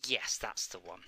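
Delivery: hushed whisper. Real, not acted. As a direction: breathe it out unvoiced. Agreeing in hushed voice.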